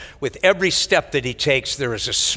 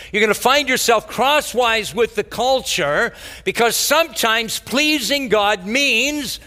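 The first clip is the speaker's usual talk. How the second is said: raised, driving preacher voice; preaching at full force; 6 semitones higher than usual. Acted. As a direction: press the point home to the congregation. Preacher building intensity.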